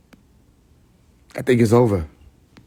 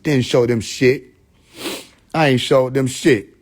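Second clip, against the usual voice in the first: normal talking voice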